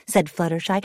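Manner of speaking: ordinary delivery, nothing marked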